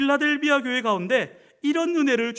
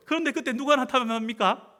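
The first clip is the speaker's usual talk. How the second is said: ordinary delivery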